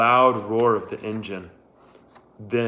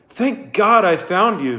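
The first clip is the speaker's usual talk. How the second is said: high, loud relieved cry; 9 semitones higher, 6 dB louder than usual. A narrator's reading. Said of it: "she sighed".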